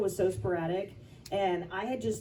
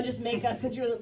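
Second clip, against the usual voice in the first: ordinary talk, nothing marked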